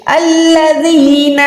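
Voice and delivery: الَّذِينَ drawn out slowly with a zabar on the alif; recited word. Shown as a demonstration. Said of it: "الف کو لام کے ساتھ ملا کے الف پہ زبر لگانی ہے".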